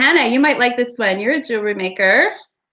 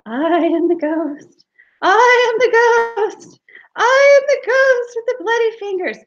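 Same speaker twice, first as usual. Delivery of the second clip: wailing ghost; 11 semitones higher, 3 dB louder than usual. Acted. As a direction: let the ghost wail full out. Ghost's third haunting.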